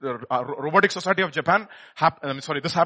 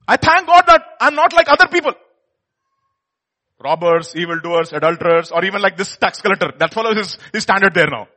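proud Pharisee; 3 semitones higher, 10 dB louder than usual. Acted. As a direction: brag to heaven, chest out. Voicing proud Pharisee prayer.

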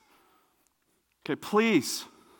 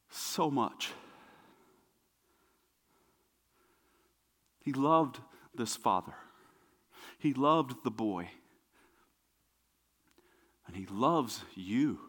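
low, soft, slow and tender; hushed preaching; 4 semitones lower, 9 dB quieter than usual. Real, not acted. Preacher on Jesus' love, hushed and slow. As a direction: let it sink in quietly.

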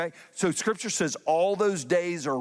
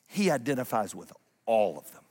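ordinary talk

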